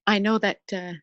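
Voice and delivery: steady yourself through real emotion, with pauses; tearful voice pushed out with effort; deeply moved. Real, not acted. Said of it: "it's very moving".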